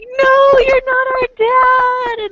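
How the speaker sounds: high squeaky kitten protest; kid voice. Retold or imitated. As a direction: shout it like a betrayed little kid. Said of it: "Owlette are gonna be like".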